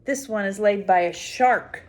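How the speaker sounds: ordinary delivery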